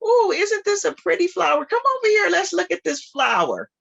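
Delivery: high, sing-song lure toward the flower; playful high voice of the crossroads; tempting crossroads voice. Acted. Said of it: "the crossroads will say".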